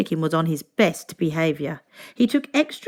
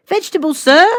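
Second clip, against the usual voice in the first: higher, louder polite waiter; raised attentive waiter voice; 8 semitones higher, 10 dB louder than usual. A narrator's reading. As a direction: offer vegetables like an eager waiter. Waiter offers at dinner.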